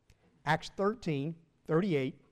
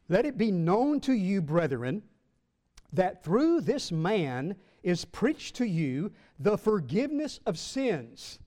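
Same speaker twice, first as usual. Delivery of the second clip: slow, raised preacher's reading voice; emphatic scripture reading; 2 semitones higher than usual. Acted. Preacher reading Paul's words to the church, slow and emphatic. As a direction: proclaim the verse with measured weight. Reads Acts to congregation.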